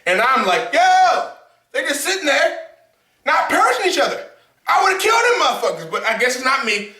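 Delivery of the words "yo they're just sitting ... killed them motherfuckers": excited. Retold or imitated. Yelling at characters.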